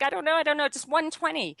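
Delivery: blurting a guess in a panic; a nervous beginner pressed at the start line, high flustered panic. Retold or imitated. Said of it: "And I'm like".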